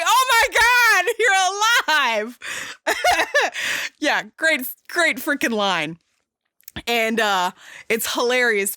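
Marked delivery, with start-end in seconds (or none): 0.0-2.4 much higher and louder, slow gushing; hammed-up breathless joy at a lost love returned; the heroine gasping over her love, high and slow; mock swoon. 2.4-3.9 high-pitched burst of real laughter; a high amused laugh after the gushing quote. Real, not acted.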